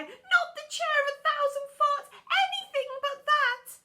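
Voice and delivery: high, loud desperate plea; pleading child. A narrator's reading. Child begging to escape the chair, high pleading wail. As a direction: beg in terror. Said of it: "the child would cry".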